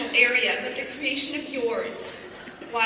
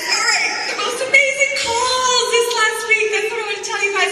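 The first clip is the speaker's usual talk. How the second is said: higher, louder, laughing off the tears; recovering with a teary laugh; laughing through tears; 8 semitones higher, 5 dB louder than usual. Real, not acted. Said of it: "I'm kinda doing an ugly cry now".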